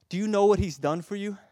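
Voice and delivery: loud, high impassioned preaching; full-force preaching. Acted. Preacher to congregation, loud impassioned preaching. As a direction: plead with fire.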